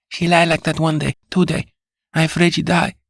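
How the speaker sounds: put-on fretting for her mistress, accented; worried Spanish accent. A narrator's reading. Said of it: "said the girl".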